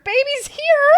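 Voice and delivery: very high, loud cheerful news; excited announcement. Retold or imitated. Telling mom the baby arrived, high and loud. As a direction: announce it with delight.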